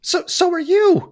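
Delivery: high, slow captive retort; elf mother. Acted.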